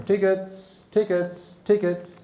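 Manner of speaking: high, repeated call down the carriage; ticket inspector call. Acted. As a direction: call out like a train conductor. Inspector calling through the carriage.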